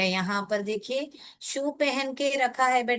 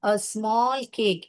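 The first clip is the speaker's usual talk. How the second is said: very slow, each word laid out for pupils; slow dictation; much slower than usual. Shown as a demonstration. Teacher dictating a meaning to pupils, very slow. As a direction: spell out the meaning slowly. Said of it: "उसका क्या meaning है?".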